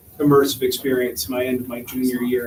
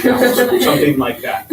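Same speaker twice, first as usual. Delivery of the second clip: laughing; 3 semitones higher, 8 dB louder, slower than usual. Real, not acted. Laughs trailing off.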